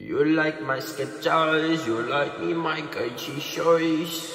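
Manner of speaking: a light mock Indian accent, slow; a hint of an Indian accent, drawn out; playful put-on Indian accent. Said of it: "I can do an Indian accent".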